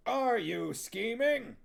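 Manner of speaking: slow, high barked drill question; Huel's drill call. Acted. Huel drilling the witches, slow high barked question. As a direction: bark it like a drill sergeant.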